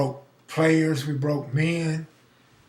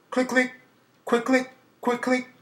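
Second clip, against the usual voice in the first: higher, quick imitated clicks; playful imitation of the game's click button; clicking noise; 9 semitones higher than usual. Shown as a demonstration. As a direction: tap the words out like a button clicking. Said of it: "we used to call it quick click".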